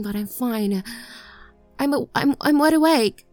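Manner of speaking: frantic pleading. Acted. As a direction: beg him not to send you to bed. Begging not to be sent to bed.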